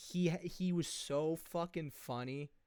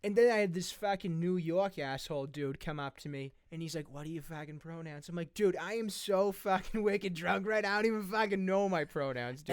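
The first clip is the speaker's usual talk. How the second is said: broad, brash Boston bro; Massachusetts accent; 3 semitones higher, 3 dB louder than usual. Acted. Drunk masshole asked about pronouns, brash Boston accent. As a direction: rant like a drunk Boston buddy. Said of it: "I just mastered the guy's accent".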